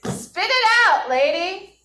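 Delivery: high, exasperated "spit it out"; impatient student. Retold or imitated. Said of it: "you're like".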